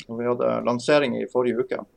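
ordinary delivery

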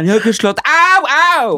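high, loud hammed-up protest and yelps; mock protest. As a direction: squeal it in fake panic. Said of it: "Og han bare". (spøkefull, lys og høy protest)

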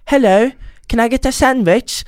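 mock someone showing off a fake London accent; fake British accent. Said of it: "går og finner seg en aksent". (hånlig falsk britisk aksent, høyt)

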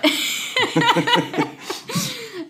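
a sudden high burst of laughter. Real, not acted. Host laughing at the guest's joke, a high burst.

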